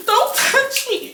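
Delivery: very high, drawn-out mimicked protest; mock shriek. Girlfriend fending off boyfriend, high-pitched mock protest. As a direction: gasp and squeal it like her.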